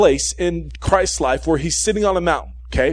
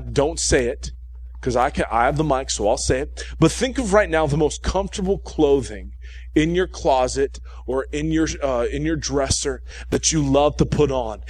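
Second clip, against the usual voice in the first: ordinary talk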